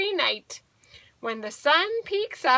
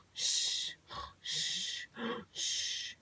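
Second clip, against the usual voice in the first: soft breathy "shh" snore; sleeping bear's whistleless snore; snoring sound; 12 dB quieter, much slower than usual. Pretend. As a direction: snore out long, hushed exhales. Bear snoring in the story.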